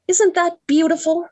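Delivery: swooning high delight; gushing. Acted. Girl gushing over her fiance's poem, swooning.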